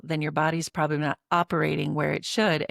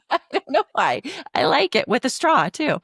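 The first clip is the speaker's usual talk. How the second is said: high and loud, laughing through the words; 8 semitones higher, 5 dB louder than usual. Real, not acted. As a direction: giggle it out, really amused. Laughing over mason jar water.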